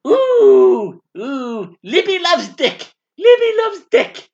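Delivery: high, wailing "ooh" then sung-out taunt; bathroom ghost-call. Acted. Libby in the haunted bathroom calling out, high playful wail. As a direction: call out high and spooky, swelling on the repeat. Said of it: "I'm in the bathroom and I'm going".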